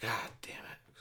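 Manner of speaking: unvoiced whispered curse, under the breath. Eating crushed pill off the floor, whispered curse. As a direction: hush it like nobody should hear. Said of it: "I was like".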